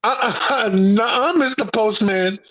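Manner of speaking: giggling through a mock refusal; half-laughing no way. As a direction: laugh while turning him down. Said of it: "I looked at him like".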